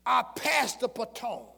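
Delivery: high, loud climactic shouting blessing; sermon climax, shouting; preaching at full force. Real, not acted. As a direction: shout the blessing over them.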